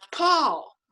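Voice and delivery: high-pitched call after someone; retold yell. Fan yells after Paul as he walks away, calling out. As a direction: call out the name, pitched well up. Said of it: "I yell out".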